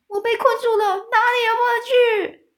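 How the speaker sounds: very high, loud shriek. A narrator's reading. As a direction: squeal it out in panic. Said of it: "尖叫说".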